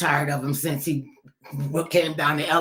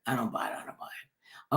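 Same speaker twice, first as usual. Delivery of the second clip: I don't buy it whispered, much softer, breath only; 6 semitones lower, 10 dB quieter than usual. Real, not acted. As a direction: let the words fade to a bare whisper. Repeating the phrase, fading.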